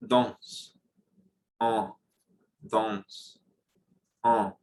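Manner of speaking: "danse" and "en" with vowel resonating in the nose; nasal drill. Shown as a demonstration. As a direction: drill "danse" nasally, one word at a time. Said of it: "There is a third one".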